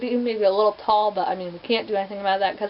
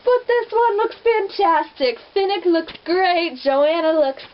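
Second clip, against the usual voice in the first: higher, louder and slow, gushing; super excited; 7 semitones higher, 3 dB louder, slower than usual. Real, not acted. Vlogger gushes to viewers about the Catching Fire cast, excited. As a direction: savour each name with delight. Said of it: "I'm super excited".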